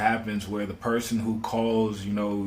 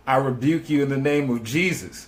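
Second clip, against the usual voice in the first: a lightly voiced churchy rebuke, a bit higher; rebuking in Jesus' name; 4 semitones higher, 3 dB louder than usual. Retelling Sonya's words to the officer, mild churchy rebuke. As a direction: retell her prayerful rebuke with mild fervor. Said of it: "And she was like".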